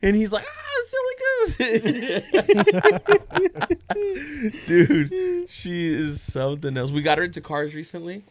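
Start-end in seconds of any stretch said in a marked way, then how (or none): 0.5-4.7 high, drawn-out laughing squeal; imitating grandpa busting up. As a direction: mimic helpless laughter through the words. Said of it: "my dad just started busting up".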